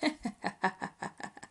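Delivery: rapid choked cackle; snickering hyena; voiceless hehe-haha chuckle; stifled hyena laugh. Acted. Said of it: "con su risa ahogada".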